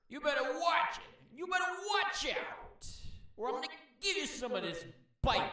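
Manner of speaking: high, loud mock-angry shout; mad snake threatening. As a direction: play an angry snake warning people off, loud and pitched high.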